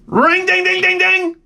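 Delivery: high chanted ding sounds; high sing-song ringing noise; imitated can rattle. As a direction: mimic the shaking can. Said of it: "And I went".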